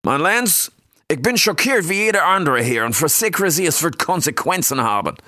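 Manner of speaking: smooth advisor voice. Acted. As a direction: assure the king, acting shocked. Adam answers the king.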